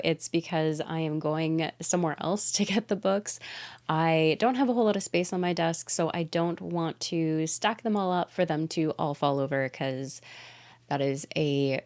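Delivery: ordinary talk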